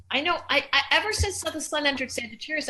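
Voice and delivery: talking through hiccups; hiccuping. Real, not acted. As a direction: hiccups breaking the words. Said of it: "Oh my God, I'm hiccuping".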